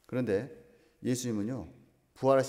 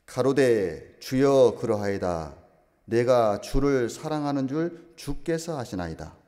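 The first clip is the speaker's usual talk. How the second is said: loud, slow, declaiming Peter's reply; a slow, loud reading of Peter's answer; solemn scripture reading; 7 dB louder, much slower than usual. Acted. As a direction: proclaim the verse slowly and fully.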